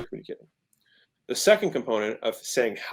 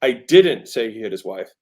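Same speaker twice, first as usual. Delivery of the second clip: "didn't" punched loud and drawn out; slow sentence leaning on "didn't"; stress on DIDN'T; 5 dB louder than usual. Shown as a demonstration. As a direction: hit the word "didn't" hard. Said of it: "The emphasis is".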